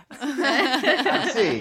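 high, laughing imitation of "dancing"; laughing mimicry. Echoing a friend's joke accent, laughing mimicry.

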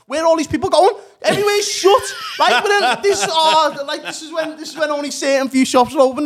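high, loud comic outrage; exasperated rant. Retold or imitated. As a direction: rant incredulously at the top of your voice. Exasperated rant about lockdown crowds.

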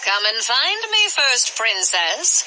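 high, scheming sorceress voice; sly sorceress. Acted. Sorceress Circe taunting Wonder Woman, sly and high. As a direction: savour the idea like a villain.

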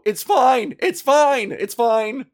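high, loud panicked reassurance; panicked yell. Retold or imitated. Startled by a raccoon, yelling to calm it, panicked. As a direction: yell it out, hands up, surrendering. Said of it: "my instinct was to go".

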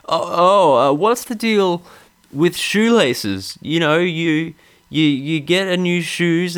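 mock a smug observational stand-up; lightly parodied sitcom comic; Jerry Seinfeld impression. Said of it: "my pseudo Jerry".

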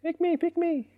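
very high squeaky voice, begging to be hit; mock squeaky plea. Coach mocking a student who begs to be hit, high squeak. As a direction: squeak it out like an eager target. Pretending to be the target.

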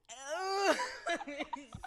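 high, loud wail with laughter; laugh-cry at your own embarrassment; mortified laughing wail. Real, not acted. Speaker cringing at their own customer story, laughing wail.

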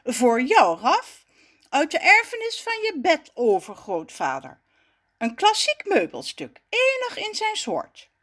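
mildly grand presentation, kept serious; straight-faced. A narrator's reading. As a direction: present the heirloom with solemn pride. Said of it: "Met een uitgestreken gezicht vervolgt hij". (uitgestreken, een tikje plechtig)

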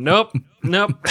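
loud mock refusal; loud nope. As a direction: bark it out, refusing. Refusing at German border joke.

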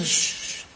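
susurro imitando un carrito de juguete; ruido de carrito. Retold or imitated. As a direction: shh suave y sin voz como un juguete rodando. Niño en su carrito. (sonido de carrito de niño, sin voz)

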